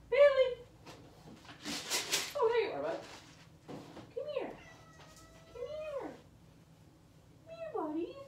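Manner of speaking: very high pet-talk voice; coaxing a pet. Real, not acted. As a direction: coax the cat over in falsetto.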